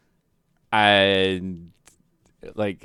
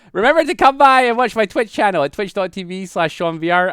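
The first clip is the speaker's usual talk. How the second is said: streamer plug; 10 semitones higher, 4 dB louder than usual. Acted. Channel plug sign-off.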